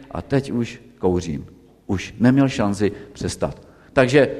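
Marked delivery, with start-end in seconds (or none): 0.1-1.4 quiet, low and resigned; sadly. Retold or imitated. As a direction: let it drop softly, defeated. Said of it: "pak řekl tak smutně". (smutně a tiše, rezignovaně)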